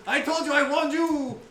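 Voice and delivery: loud, high pompous warning; hammed-up indignant highwayman; scolding swashbuckler. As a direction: scold them with theatrical bravado. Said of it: "He goes".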